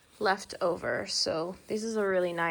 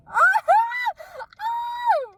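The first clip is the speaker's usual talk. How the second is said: high shrieking laugh; 7 dB louder than usual. Real, not acted. Overwhelmed by excitement.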